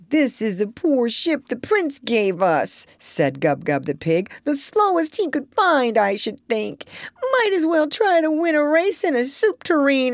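high-pitched complaining pig; grumbling pig Gub-Gub. A narrator's reading.

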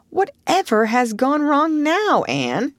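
alarmed query, pitch jumping up then a rising Anne; doubt and dismay. Acted. Marilla asks Anne in doubt and dismay. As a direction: Marilla startled at Anne's sobbing. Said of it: "queried Marilla in doubt and dismay".

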